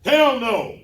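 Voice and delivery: loud, high blunt shout; gruff one-word answer. Retold or imitated. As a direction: bark it like the strong silent type. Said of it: "strong silent type, one word answers".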